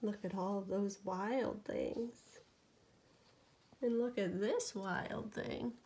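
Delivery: lively bedtime storytelling; animated picture-book reading; reading to a child. A narrator's reading. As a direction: read it to a sleepy toddler. Reads bedtime story aloud.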